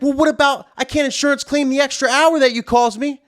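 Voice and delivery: high, indignant counter-demand; angry comeback. Retold or imitated. DJ demanding payback for the extra hour, indignant. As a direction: throw it back at her, pitch up. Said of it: "getting even more mad".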